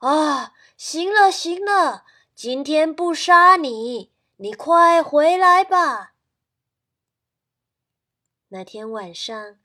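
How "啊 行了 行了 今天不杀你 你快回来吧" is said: loud, higher calling out; shouting. Acted. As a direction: grandparents calling the rooster back. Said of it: "喊话". (大声喊话)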